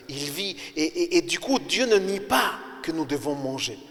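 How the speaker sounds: normal talking voice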